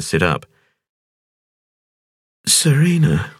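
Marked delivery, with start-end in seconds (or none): 2.5-3.3 a high woman's voice, dazed mumble falling away. A narrator's reading. Injured woman waking on the road, dazed mumble. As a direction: murmur the name half awake. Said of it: "she mumbled".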